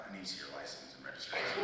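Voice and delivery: a hint of a flat cop; police officer. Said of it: "the cop comes to the window and he says".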